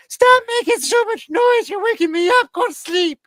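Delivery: very high, loud mock wife voice; shrill it up like a grumpy woken wife; high, loud wife scolding from bed; wife's voice. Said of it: "and the wife says".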